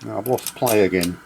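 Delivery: usual speaking voice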